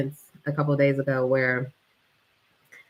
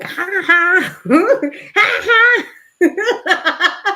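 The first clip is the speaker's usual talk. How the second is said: high, loud cackling mock laugh; imitating a wild, unhinged laugh; 7 dB louder, much slower than usual. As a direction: cackle like a woman gone unhinged. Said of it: "so unhinged when she did it".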